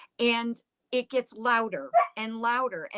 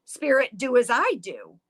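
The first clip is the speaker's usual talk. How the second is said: louder, raised call to a spirit; mock yell; 3 semitones higher, 7 dB louder than usual. Older sister challenging the spirit, loud mock yell. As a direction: call out firmly, as if yelling. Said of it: "she yells out".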